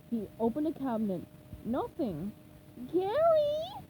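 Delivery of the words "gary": much higher, louder call; worried call. Acted. SpongeBob searching frantically for Gary, high worried call.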